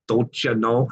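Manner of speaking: Minnesota catchphrase accent. Shown as a demonstration. Host showing the one bit he can do, Minnesota accent. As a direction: put on a brief Minnesota accent. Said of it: "I can get the".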